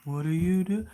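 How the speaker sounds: drawn-out, eerie singsong call; spooky camera voice. Retold or imitated. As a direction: creep him out with stretched, rising words. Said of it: "I'll turn the camera on. I'm like".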